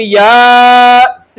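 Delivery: long-held vowels in "Yasin"; drawn-out recitation. Shown as a demonstration. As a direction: stretch both syllables of "Yasin" for several beats. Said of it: "खींच कर पढ़ेंगे".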